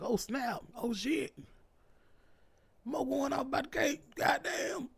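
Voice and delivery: high, hyped-up gossiping friends; shocked friends voice. Retold or imitated. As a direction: gasp over the drama like gossip. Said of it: "they was like".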